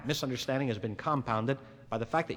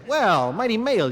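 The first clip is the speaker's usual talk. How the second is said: sarcastic, louder and higher, drawn out slowly; mocking jab; 7 semitones higher, 8 dB louder, slower than usual. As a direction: sneer the words at him as she leaves. Girlfriend's mocking parting shot, quoted.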